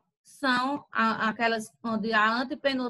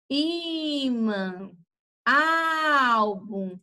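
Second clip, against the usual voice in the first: first syllables of imã and álbum drawn out long; sílaba tônica alongada; much slower than usual. Shown as a demonstration. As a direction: call out imã, álbum slowly, holding I and ÁL. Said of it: "ela vai ser mais forte". (imã e álbum com a primeira sílaba bem esticada)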